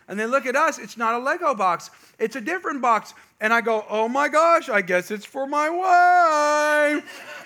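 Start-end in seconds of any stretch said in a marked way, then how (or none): 3.9-7.4 loud, high, stretched-out mock amazement; feigned surprise. Dad revealing the gift is for his wife, mock surprise. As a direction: act surprised, dragging out the last word.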